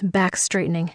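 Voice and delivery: usual speaking voice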